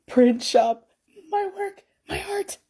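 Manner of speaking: high, squealing announcement; excited squeal. Real, not acted. Artist announces her print shop, squealing with joy. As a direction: squeal it out with pure glee.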